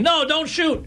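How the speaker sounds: high, thin mock panic from a man in a cannon; panicked plea. Imitating the cannon man begging not to be shot, high panicked squeal. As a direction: squeal it like you're about to be fired. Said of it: "the guy that stood in the cannon going".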